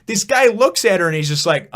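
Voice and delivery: ordinary delivery, nothing marked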